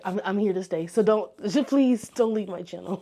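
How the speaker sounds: ordinary delivery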